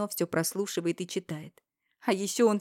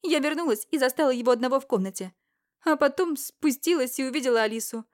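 higher, louder, fast female voice; Irina's urgent revelation; 7 semitones higher, 6 dB louder than usual. Acted. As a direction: blurt out the truth. Ирина рассказывает. (взволнованная Ирина, выше и громче)

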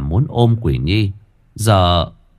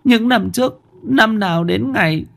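high mother's voice, tearful confession; a mother admitting a lost child, voice raised high; grieving mother; 13 semitones higher than usual. Acted. Narrator voices Bà Thủy.

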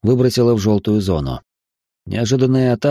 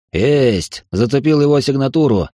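excited, raised lock-on shout; combat callout; 5 semitones higher, 3 dB louder than usual. Acted. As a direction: call out the lock triumphantly. Gunner locks on target.

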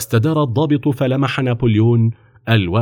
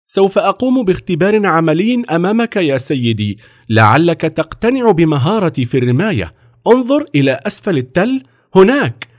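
put-on higher-pitched boyish eagerness; keen determined boy; 7 semitones higher than usual. A narrator's reading. Napoleon offering the officer a test, eager. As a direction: make the offer in a high young voice.

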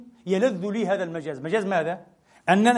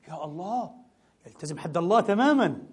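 ordinary talk, nothing marked

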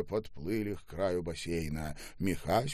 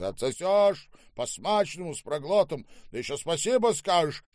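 raised, high-pitched threat; menacing bullying; 9 semitones higher, 6 dB louder than usual. Acted. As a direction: overrule the refusal by force. Штаубе threatens and bullies. (злобно, с нажимом)

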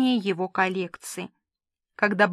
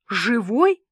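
startled. Acted. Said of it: "подпрыгнула я".

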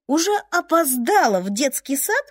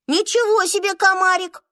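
indignant exclaim; 8 semitones higher than usual. A narrator's reading. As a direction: cry out in outrage. Indignant child's view. (возмущённо)

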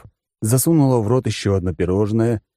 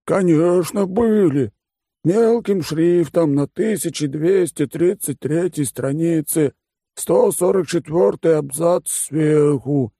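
high, slow, lazy condescending voice; bored rich glutton explaining terms; smug fat oligarch; 8 semitones higher, slower than usual. Acted.

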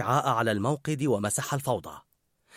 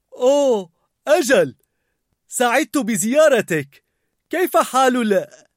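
put-on nervous young man; 11 semitones higher, 8 dB louder than usual. A narrator's reading. Linguini greeting the chef nervously. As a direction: stammer out a polite hello.

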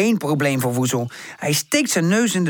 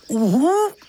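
high questioning hoot; a puzzled owl's hoo; lightly voiced owl not understanding; 9 semitones higher, 3 dB louder than usual.

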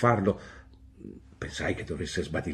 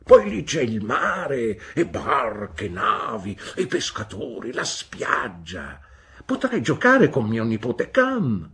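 louder, eager list of delights; enthusiastic listing; 4 semitones higher, 7 dB louder, slower than usual. Acted. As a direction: sell the seaside with relish. Peggotty lists seaside delights.

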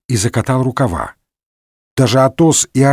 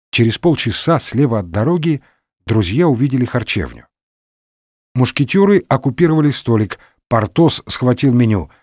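plain reading voice

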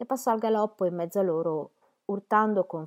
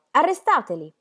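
higher, louder command to the guards; shouted order; 7 semitones higher, 7 dB louder than usual. A narrator's reading. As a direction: bark it at the guards across the gate. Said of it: "gridò". (ordine gridato, più acuto e più forte)

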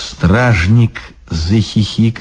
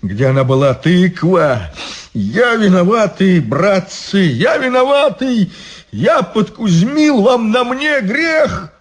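high, strained bitter lament; bitter self-reproach; 9 semitones higher than usual. Acted. Ivan blaming himself before the monks, bitter. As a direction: blame yourself aloud. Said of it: "сказал горько".

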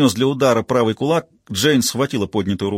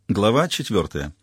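plain reading voice

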